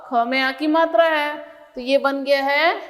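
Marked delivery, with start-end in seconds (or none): none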